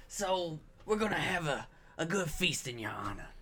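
usual speaking voice

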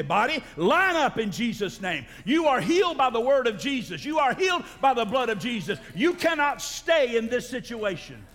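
high, forceful faith declaration; commanding in prayer. Acted. Preacher commanding a sick body to heal, forceful declaration.

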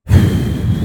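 long tired sigh; a fed-up breathy sigh; weary sigh. Real, not acted. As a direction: sigh out the exhaustion. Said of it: "I'm really over it".

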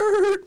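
short, loud high screech; imitated tire screech. Imitating the Honda stopping next to him, loud screech. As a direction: mimic brakes screeching to a stop. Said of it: "it's like".

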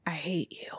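hushed whisper; mock-bitter whisper to the cops. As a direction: breathe the words out bitterly. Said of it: "Johnny Utah is like".

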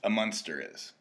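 usual speaking voice

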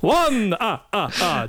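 counting with a hammy vampire laugh; Count von Count laugh. Acted.

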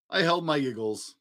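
plain reading voice

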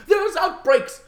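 shouted high and loud, hammed-up panic; mock alarm. Host hamming up the outbreak news to his co-host, in a loud, high mock-panic yell. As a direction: sound the alarm, over the top.

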